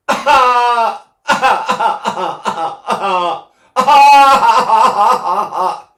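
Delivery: put-on loud, high baby wail in coughed bursts; coughing baby cry. Voice teacher showing what babies sound like, coughing baby cry. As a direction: imitate a crying baby, staccato "ah"s. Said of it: "He's coughing. He's going".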